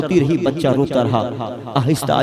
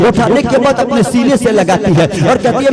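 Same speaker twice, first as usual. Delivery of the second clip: storytelling that swells a little louder and higher; rising sermon narration; 4 semitones higher, 4 dB louder than usual. Acted. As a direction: build the story toward the mother's words.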